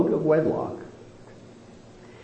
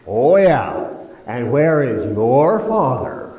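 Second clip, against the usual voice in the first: loud, drawn-out sarcastic challenge; 6 dB louder, slower than usual. Acted. Pharisees questioning Jesus to slight his birth, loudly sarcastic. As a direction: throw it out as a slow, loud taunt. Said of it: "sarcastic statement".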